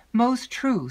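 high, loud, eager agreement. A narrator's reading. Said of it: "said Emily eagerly".

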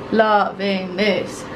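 LOVING THIS stretched out slowly; drawn-out relish. Real, not acted. Vlogger delighted with the cabin, slow savouring repeat.

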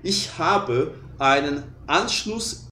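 slow, careful German sentence; teacher modeling German slowly; slow German demo. Shown as a demonstration. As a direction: spell out each German word slowly. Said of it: "Você fala assim".

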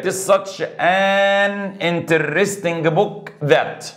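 drawn-out, spaced answer; slow dictation. Shown as a demonstration. Teacher dictating the correct sentence to the class, slowly. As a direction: spell out the answer slowly for students. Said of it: "teacher dictates the answer".

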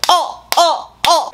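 ordinary talk, nothing marked

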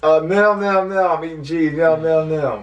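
high, loud, repeated squeals; flustered character voice. Shown as a demonstration. Caught eating cheese by the chef, flustered. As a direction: squeal through a cornered excuse.

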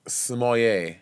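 "Smollett" said as smoll-YAY, low; French-style Smollett. Shown as a demonstration. Answers with French-style pronunciation.

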